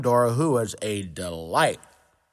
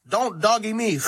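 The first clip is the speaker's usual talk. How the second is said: mock angry dragon roar; 6 semitones higher than usual. Dragon roars at Dora, mock angry. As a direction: bellow it, offended. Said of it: "Donald roared".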